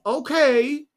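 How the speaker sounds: high, doubtful American "okay"; put-on American tourist going along with it; mock American accent. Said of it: "That's my accent at the moment for the Americans".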